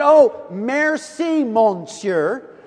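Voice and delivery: high, loud, drawn-out gushing thanks; lost schoolgirls thanking a stranger in bad French; relieved gushing. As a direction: gush with relief like a rescued schoolgirl.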